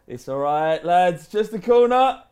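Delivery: loud, slow call to teammates. Acted. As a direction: shout it across the pitch, drawn out. Player reassures teammates after own goal.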